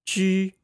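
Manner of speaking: Ju held high and level with rounded lips; pinyin ju first tone. Shown as a demonstration. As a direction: say Ju on one high flat pitch. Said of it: "let's practice that".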